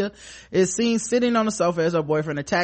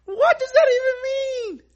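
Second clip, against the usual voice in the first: shouted much higher and louder through laughter; laughing shout; 7 dB louder than usual. Real, not acted. Host baffled by the boyfriend's logic, laughing shout. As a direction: cry it out while cracking up.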